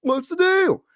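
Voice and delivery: very high, hammed-up stand-up comic voice; Jerry Seinfeld. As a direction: squeal it like a bad observational-comedy parody. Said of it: "trying to do my Jerry Seinfeld impression".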